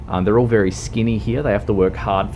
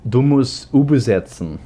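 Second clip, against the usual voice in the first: mock German; 6 semitones higher, 5 dB louder than usual. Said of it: "German role play, louder".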